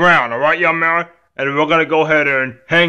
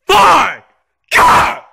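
high, defiant tantrum yell; screaming; 12 semitones higher, 4 dB louder than usual. Acted. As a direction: shout it and finish with a scream. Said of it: "stop screaming".

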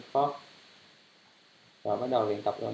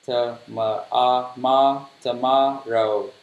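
slow reading; 4 dB louder, much slower than usual. Shown as a demonstration. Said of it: "âm đây là âm O".

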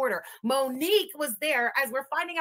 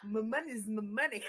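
mimicking pimp drawl; 4 semitones lower, 10 dB quieter than usual. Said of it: "Why did he say it like that".

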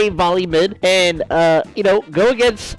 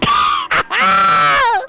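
high shouting laugh; wild mocking cackle; loud cackle; 4 dB louder than usual. Real, not acted. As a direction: burst out cackling. Laughing at enemies.

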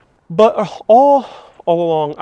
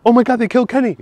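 South Park quote; 9 semitones higher, 5 dB louder, much faster than usual. Retold or imitated. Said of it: "It reminds me of South Park".

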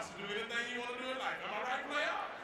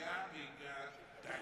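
slow amazed mutter; 6 semitones lower, 4 dB quieter, much slower than usual. Acted. Realising it was a Caprice.

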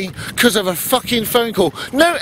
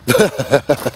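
short laugh; 8 semitones lower than usual. Real, not acted. Laughing at the copper's question.

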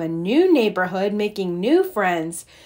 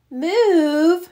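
shocked high cry, rising; a cub stunned by the news; cried out; 7 semitones higher, 3 dB louder than usual. A narrator's reading. Brother Bear crying out to Papa in disbelief. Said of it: "cried Brother Bear".

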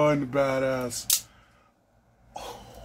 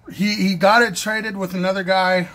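ordinary talk

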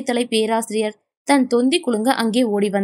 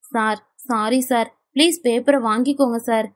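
plain reading voice

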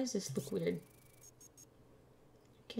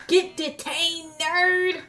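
gleeful mocking yell; 9 semitones higher, 9 dB louder, slower than usual. Gloating at the detained traveller, mocking yell. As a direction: shout it high and loud, gloating. Taunting a detained game entrant.

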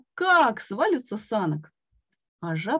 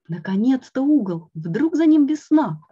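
hopeful excitement; 5 semitones higher, 7 dB louder than usual. A narrator's reading. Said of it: "подумал я".

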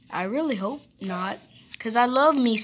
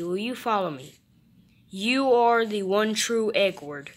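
ordinary talk

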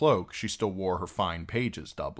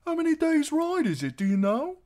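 put-on very high, loud boy's voice; eager piping; 7 dB louder than usual. A narrator's reading. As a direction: pipe up like a keen lad. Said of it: "Hot Pie piped up eagerly".

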